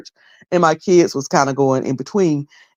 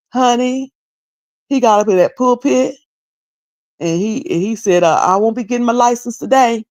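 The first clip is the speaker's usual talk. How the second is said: voicing daughter; 6 semitones higher than usual. Daughter retelling gossip.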